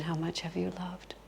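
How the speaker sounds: near-whisper, very soft; solemn, whispered reminder; hushed quoted question. Retold or imitated. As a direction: breathe the question out quietly.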